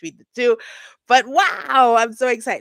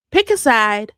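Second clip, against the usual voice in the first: firm, louder repeat; louder demand; 3 semitones higher, 9 dB louder than usual. Real, not acted. Scolding a troll in the comments, louder repeat. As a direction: say it again, harder.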